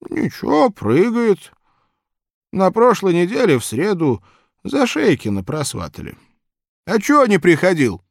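put-on high, loud chatty old man. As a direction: ramble like a cheerful old man.

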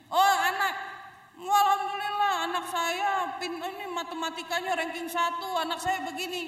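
proud parent bragging. Retold or imitated. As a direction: gush about the child's ranking. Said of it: "begitu nggak usah diceritain". (ibu pamer anak dengan bangga)

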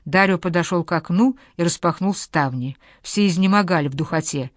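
plain reading voice

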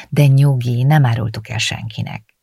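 ordinary delivery

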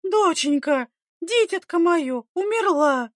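high, drawn-out lament; anguished wail. A narrator's reading. Mother mourning her daughter indoors, anguished wail. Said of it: "надрывалась женщина в доме".

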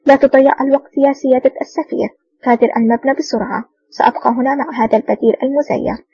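ordinary delivery

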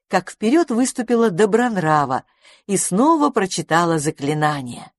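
ordinary delivery

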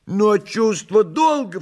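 high, slow cat voice; Behemoth the cat, pompous. Acted. Behemoth joining the tale, high pompous voice. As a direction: declare it high and grandly.

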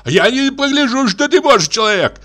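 very high, worked-up yelling; furious high-pitched threat; squealing angry shout. A narrator's reading. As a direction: lash out in a screechy high voice. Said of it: "визжащим голосом Кузьма".

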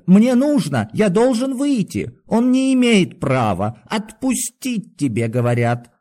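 very high, strained insistence; desperate demand. A narrator's reading. Doctor demands the guard release him, desperate.

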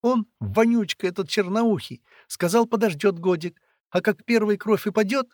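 put-on high, loud distressed girl; tearful. A narrator's reading. Girl telling of the chief's plan, tearful. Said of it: "слёзы закапали сильнее".

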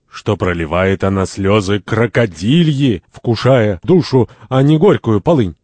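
slow, stagy grandiloquence; with pathos. Acted. Mocking Santori's crocodile tears, pompous pathos. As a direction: declaim it grandly, drawing out the words. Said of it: "С пафосом".

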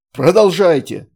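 loud sharp barked order. A narrator's reading. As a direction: bark the command. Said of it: "рявкнул Май".

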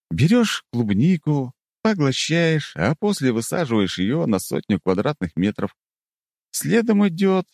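slow, mocking explanation. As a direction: explain like to a child. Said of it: "издевательски начал я".

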